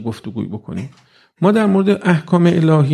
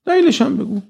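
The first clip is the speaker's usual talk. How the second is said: دلیلش هم بگو pitched far higher, insistent; demanding high pitch; 12 semitones higher than usual. Shown as a demonstration. Preacher voices youth demanding reasons from God, high insistent pitch. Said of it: "دقت کن اینا چجوری".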